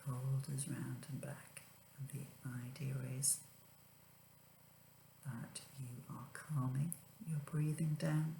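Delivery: whispered relaxation guide; soft spa whisper. Acted. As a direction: breathe it out softly. Guided breathing exercise.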